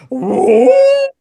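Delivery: loud, very high drawn-out whoop; piercing vocal guitar noise; imitated guitar wail. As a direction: mimic a guitar screaming. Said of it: "gets a hold of his guitar and goes".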